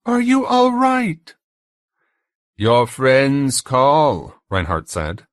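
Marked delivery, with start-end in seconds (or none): 0.1-1.3 high, slow, loud distant call; woman calling. A narrator's reading. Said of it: "a woman's voice". 2.6-4.3 very slow, loud German interrogator; German accent. A narrator's reading. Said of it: "The accent was German".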